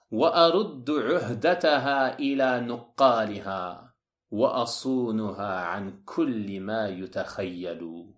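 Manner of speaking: slow intoned couplet, falling in the second half; Arabic verse recitation. Acted. Lecturer quoting the author's verse to listeners, slow intoned recitation. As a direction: chant the verse slowly, drawing out the vowels.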